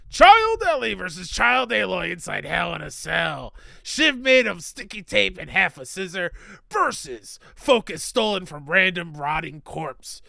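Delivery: loud hyped wrestling announcer; wrestling promo voice. Pretend. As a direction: bellow it like a pay-per-view hype man. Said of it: "Insert wrestling promo voice here".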